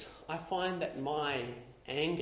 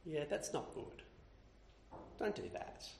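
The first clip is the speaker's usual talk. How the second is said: gentle rebuke; 8 dB quieter than usual. Retold or imitated. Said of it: "And James says".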